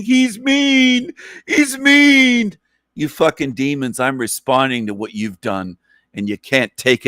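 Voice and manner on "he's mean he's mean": mock whine, much higher and louder; whiny mockery. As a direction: mimic a crybaby tattling. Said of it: "point the finger saying".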